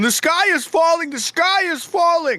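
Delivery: high, loud panicked cry; hammed-up Chicken Little shriek. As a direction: run around yelling that the sky is falling. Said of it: "I'd run around the town going".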